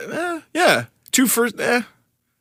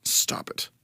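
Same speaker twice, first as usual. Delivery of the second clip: whispered; 12 dB quieter than usual. Real, not acted. Exasperated at spelling.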